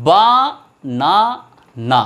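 বা - না - না in three separate beats; even pauses between বা, না and না; syllable by syllable. Shown as a demonstration. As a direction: sound out বানানা one syllable at a time. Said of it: "এই ওয়ার্ডটা দেখো".

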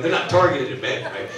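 usual speaking voice

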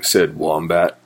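ordinary delivery, nothing marked

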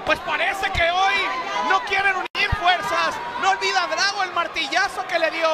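high-energy live ringside call; wrestling commentator. Acted. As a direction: call the action as it happens. Live play-by-play of a match.

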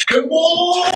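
high yelled cannonball call; excited yell. Real, not acted. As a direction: call it as you jump. Jumping into the tub.

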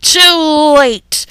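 loud, high villainous announcement; villain trio. Acted. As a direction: burst in like cartoon villains. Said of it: "three familiar voices said".

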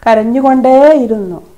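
usual speaking voice

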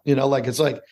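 usual speaking voice

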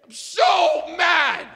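slow, high, loud acted fury; seething comic outburst, drawn out; totally lost it. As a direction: stretch it out in a hammed-up shout. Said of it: "I've totally lost it".

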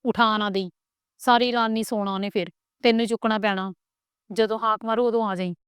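ordinary delivery, nothing marked

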